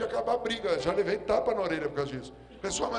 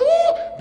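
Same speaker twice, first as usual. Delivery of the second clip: very high, loud joyful whoop; wild excited uh; celebratory whoop; 15 dB louder than usual. Retold or imitated. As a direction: let out a shriek of delight. Celebrating father's vigor.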